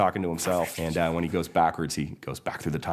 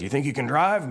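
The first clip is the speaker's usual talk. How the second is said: higher questioning lawman character; lightly voiced sheriff checking on someone; 9 semitones higher, 4 dB louder than usual. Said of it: "Sheriff says".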